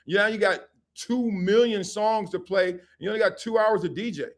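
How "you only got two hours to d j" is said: ordinary talk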